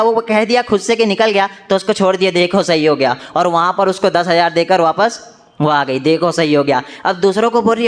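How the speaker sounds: normal talking voice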